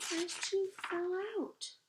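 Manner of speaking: high, loud, delighted announcement; excited. A narrator's reading. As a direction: cheer it out for the children. Repeats the climax.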